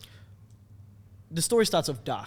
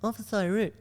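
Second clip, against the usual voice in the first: higher cheeky driver voice; skit driver; 6 semitones higher than usual. Retold or imitated. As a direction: toss it off cheekily. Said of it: "That's the one everyone loves".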